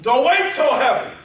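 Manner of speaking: high, loud call and refrain; preaching at full shout. Acted. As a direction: shout each call, drop on worship him. Repeated shouted exhortation.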